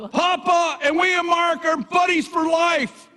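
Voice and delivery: high, drawn-out happy shout; joyful yell. Retold or imitated. Grandfather yelling to his little grandson, high and slow. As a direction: yell it proudly so he hears. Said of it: "And then I'll yell".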